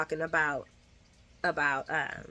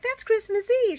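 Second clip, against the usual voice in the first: high, louder "That's Christmas Eve"; mock shock; 6 dB louder than usual. [name] objecting to Tracy's meeting: high mock shock. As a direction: squeak it out in disbelief.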